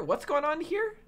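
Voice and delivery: ordinary talk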